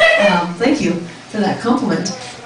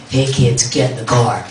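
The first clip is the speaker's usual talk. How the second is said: very low creepy man's voice; weirdo man; 9 semitones lower than usual. Acted. Weirdo in a van calling kids, low creepy voice. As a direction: drop deep to play a van creep. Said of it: "this weirdo man's gonna pull up".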